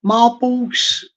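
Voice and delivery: ordinary talk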